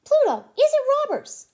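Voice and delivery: high, loud squeaky Mickey Mouse voice; excited squeaky mouse voice asking the dog; high eager Mickey. Acted. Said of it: "Mickey asked".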